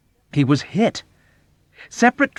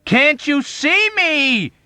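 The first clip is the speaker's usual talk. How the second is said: very high, loud, slow; incredulous cry; 7 dB louder, much slower than usual. Acted. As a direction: cry out in disbelief. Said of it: "Yossarian cried incredulously".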